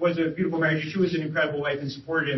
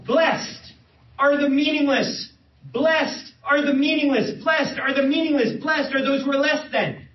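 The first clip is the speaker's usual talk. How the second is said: raised, emphatic preacher's cadence; preaching; 7 semitones higher than usual. Acted. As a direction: proclaim each blessing to the congregation.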